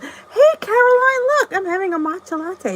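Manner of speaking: light up at seeing a friend; excited greeting. Real, not acted. Greeting viewer Caroline with a high excited squeal.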